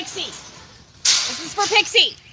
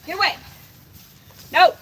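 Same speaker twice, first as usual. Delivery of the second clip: normal talking voice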